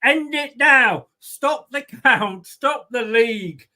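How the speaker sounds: slow, emphatic mock-presidential demand; Donald Trump. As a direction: command it like a politician, word by word. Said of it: "here's my Donald Trump impression".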